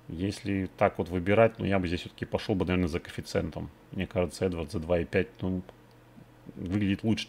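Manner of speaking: ordinary talk, nothing marked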